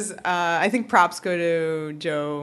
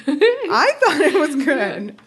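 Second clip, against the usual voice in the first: laughing it out, higher and louder; 8 semitones higher, 6 dB louder, slower than usual. Real, not acted. Host defending the line while laughing. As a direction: say it through a real laugh.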